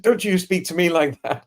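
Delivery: a mock-offended teacher snapping back, half laughing; mock stern teacher. Teacher scolding a child, mock indignant rebuke.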